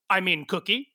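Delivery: ordinary delivery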